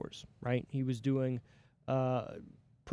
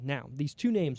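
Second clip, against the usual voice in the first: ordinary talk, nothing marked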